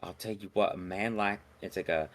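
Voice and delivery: soft, purring sweet talk to a customer; seductive Twi'lek. Acted. Dancer sweet-talking her regular customer, soft and seductive. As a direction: coo at him like a flirt. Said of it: "the seductive Twi'lek face goes back on".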